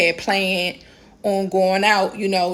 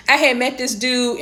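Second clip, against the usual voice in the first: ordinary talk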